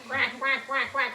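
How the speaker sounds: playful run of duck quacks; duck quacking. Pretend. Teacher shows children how to waddle like a duck, quacking. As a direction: quack quickly in an even string. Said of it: "walk around like a duck".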